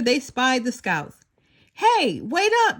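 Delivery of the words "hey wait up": calling out loud and high; loud high hail across the street; yelled. A narrator's reading. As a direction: shout to the scouts down the street. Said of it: "yelled the baby's mother".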